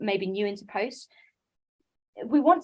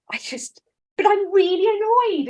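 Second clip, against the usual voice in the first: high, loud, annoyed whine; mock-grumpy wife snapping at husband; grumpy outburst; 11 semitones higher, 9 dB louder than usual. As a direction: blurt it out grumpily, pitched way up. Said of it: "I was so grumpy".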